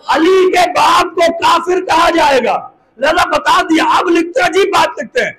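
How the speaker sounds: high, sustained, fervent pulpit cry; climactic preaching. Acted. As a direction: build the argument to a ringing climax.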